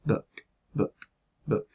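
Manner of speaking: book with a short lax u between u and o. Shown as a demonstration. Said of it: "la palabra inglesa book".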